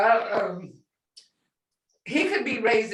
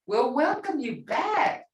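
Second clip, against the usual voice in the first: welcoming; 9 semitones higher, slower than usual. Shown as a demonstration. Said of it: "That's saying".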